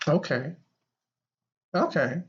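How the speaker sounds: normal talking voice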